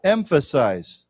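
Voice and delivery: "Emphasize" drawn out slowly for repetition; model pronunciation. Shown as a demonstration. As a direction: say "Emphasize" slowly and clearly, falling. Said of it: "let's say together".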